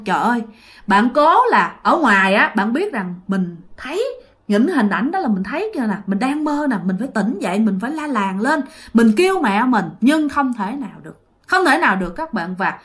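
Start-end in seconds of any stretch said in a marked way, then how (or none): none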